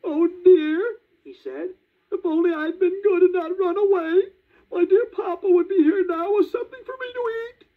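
put-on very high, crying puppet boy. A narrator's reading. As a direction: sob it out as the lonely puppet. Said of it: "Pinocchio began to cry".

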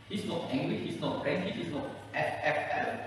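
normal talking voice

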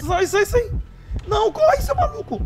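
high, loud frantic shouting; panicked yell. Real, not acted. Panicked yelling at game chase.

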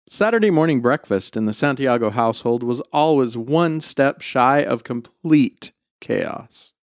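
ordinary delivery

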